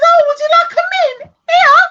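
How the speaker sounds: high mock-polite offer, fast; sweetly pushing a fluffy old mint. Mimicking a stranger offering a stale mint, high and sweet. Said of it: "and it says".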